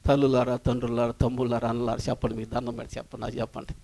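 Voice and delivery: low, soft appeal to the congregation; soft begging, voice dropped right down; hushed pleading. Real, not acted. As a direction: plead quietly, fading almost to nothing.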